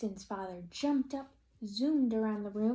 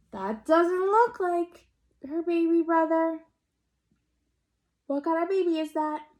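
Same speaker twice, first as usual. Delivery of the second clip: higher, louder alarm; shocked mother; 8 semitones higher, 8 dB louder than usual. Acted. Mother seeing the seal, high and loud. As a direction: let the calm break into shock. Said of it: "said her mother".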